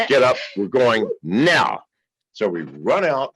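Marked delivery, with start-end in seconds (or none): 0.1-1.8 loud retold command, slowing for emphasis; urgent order. Man waking Janice to flee, loudly urgent. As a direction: order them up, landing hard on the last word.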